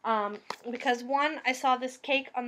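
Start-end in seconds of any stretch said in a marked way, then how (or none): none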